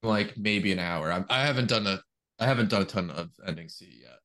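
ordinary talk, nothing marked

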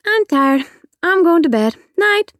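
put-on high, sleepy little girl saying goodnight; a tired child voice, drifting off; child heading to bed, high and sleepy. A narrator's reading. She yawned.

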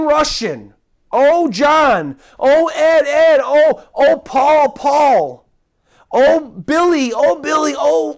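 high, loud longing cries; pleading calls. Acted. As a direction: call each one home like a grieving father. Pleading calls to each listener.

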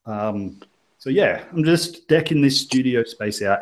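normal talking voice

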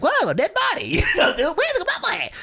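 high, goofy wide-eyed comedic read. Retold or imitated. Comedian re-enacting his goofy audition line, comedic. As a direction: play the corpse for laughs. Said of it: "I read it as in a comedic tone".

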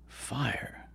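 hushed, breathless whisper. A narrator's reading. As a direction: whisper it like a secret. Coyote hushed at hearing of fire.

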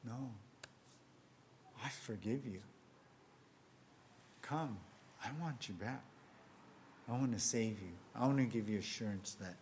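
Jesus speaking tenderly. Acted. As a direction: speak as a loving Jesus calling back a lost child, hushed. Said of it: "But Jesus is saying".

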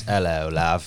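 lightly put-on British accent; a low, brief British greeting; a hint of a British "Hello, love.". Shown as a demonstration. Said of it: "That's my entire British accent".

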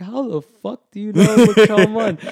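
loud, high-pitched happy disbelief; brightened amazement. Retold or imitated. Matt astonished the student knows his old rival, brightened amazement. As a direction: burst out astonished but not mad. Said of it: "his face brightened up. He wasn't even mad".

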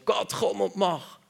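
high, slow begging call to God; pleading cry to God. Retold or imitated. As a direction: plead upward, drawn out. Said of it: "schreien nicht zu Gott". (hoch, gedehnt und flehend)

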